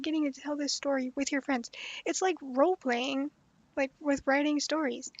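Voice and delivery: ordinary talk, nothing marked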